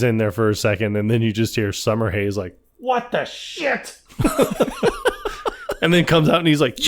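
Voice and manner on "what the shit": high, drawn-out mock cry, held back in volume; stretched-out, high exclamation, half-laughing; retold outburst. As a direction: imitate an outraged boss with slow, high words. Retells boss's outburst from office.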